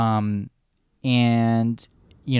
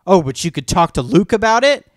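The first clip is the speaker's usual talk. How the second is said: jealous retort; 8 semitones higher, 7 dB louder than usual. Retold or imitated. Acted jealous Han quote.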